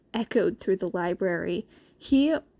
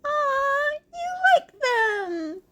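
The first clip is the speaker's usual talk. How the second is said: high, sing-song teasing with a laugh; playful coo at a friend; teasing Izuku; slower than usual. A narrator's reading. Izuku teasing Shinso, high sing-song and laughing.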